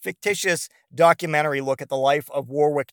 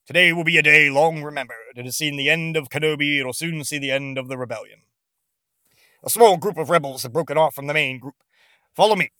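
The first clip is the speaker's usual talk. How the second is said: loud, booming commander, a hint of Vader; boisterous Vader; 4 dB louder than usual. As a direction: proclaim it to the whole fleet.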